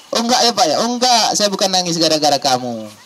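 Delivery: animated father setting his son straight; emphatic denial. Acted. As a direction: brush off the idea, pitched well up.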